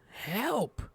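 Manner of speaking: play-distressed cries for rescue; mock call for help. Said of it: "I'm calling for dev help".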